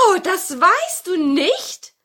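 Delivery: high, slow, loud question; astonished witch. Acted. Witch is surprised at grandmother's question, high astonished voice. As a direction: ask with drawn-out amazement. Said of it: "sagte die Hexe".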